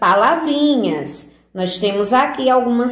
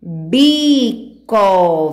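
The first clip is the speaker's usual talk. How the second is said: BICO drawn out, BI then CO; sílabas juntadas devagar. Shown as a demonstration. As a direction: sound out BICO slowly for young readers. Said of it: "Juntamos as duas e formamos a palavrinha". (palavra esticada, sílaba por sílaba)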